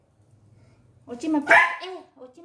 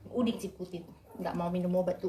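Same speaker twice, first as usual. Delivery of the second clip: ordinary talk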